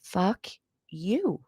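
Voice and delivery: softly placed "Fuck... you", word by word; "Fuck you" in two soft, separate beats; deliberate enunciation. Shown as a demonstration. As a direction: say "Fuck", pause, then a quiet "you". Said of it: "I pronounce that without hesitation".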